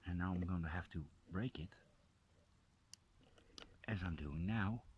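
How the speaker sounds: quick hushed whisper. Real, not acted. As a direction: whisper it fast, like a small confession. About to break the mushroom.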